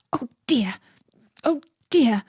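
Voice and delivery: anxious breathy whisper to itself. A narrator's reading. Rabbit whispering worriedly to itself, late. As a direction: fret under the breath, hurrying. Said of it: "say to itself".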